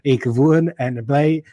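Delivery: put-on lightly done flat Afrikaans accent, slow at the end; monotone Afrikaans accent. South African host imitating Afrikaans speakers, monotone Afrikaans accent. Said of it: "it's very monotone".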